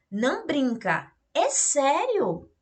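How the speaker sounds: playful shocked exclamation; surprised disbelief. Shown as a demonstration. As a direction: sound like you can't believe it. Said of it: "até a voz muda".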